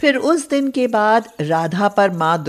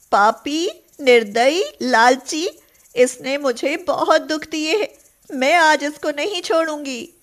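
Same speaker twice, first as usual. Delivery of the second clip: higher, furious name-calling; angry cow; 7 semitones higher than usual. A narrator's reading. Cow Radha rages at the milkman, higher and furious. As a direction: spit out the insults. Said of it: "क्रोध में जलने लगती है".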